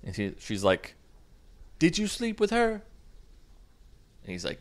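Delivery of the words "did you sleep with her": high-pitched jealous girlfriend imitation; mock irrationally mad. Girlfriend mad that this girl knows him, high mock accusation. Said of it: "she gets irrationally mad".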